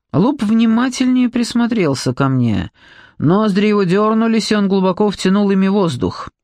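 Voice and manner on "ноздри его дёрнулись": plain reading voice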